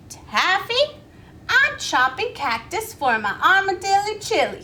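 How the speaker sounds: cheerful Miss Billy. Acted. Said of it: "said Miss Millie".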